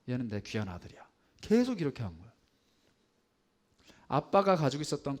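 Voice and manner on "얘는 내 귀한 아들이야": softer, hushed words of pride in a son; tender pride. Retold or imitated. Father softly praising his favourite son to the brothers. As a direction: drop to a fond, protective hush.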